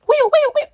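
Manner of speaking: high, loud wailing police siren sound; siren imitation. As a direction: mimic cop cars pulling up.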